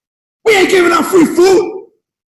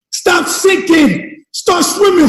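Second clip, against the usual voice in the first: drawn out and punched, word by word; preacher pounding the slogan at his listeners; slow emphatic slogan; much slower than usual. Acted. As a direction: hammer each word home. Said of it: "slogan chant".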